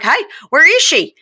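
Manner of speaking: imitating a puzzled call. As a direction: call out looking for her. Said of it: "And I was like".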